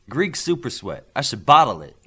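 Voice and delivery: boastful joking brag; showing off to lighten the mood; mild playful swagger; jokingly. A narrator's reading. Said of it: "Olympian said jokingly".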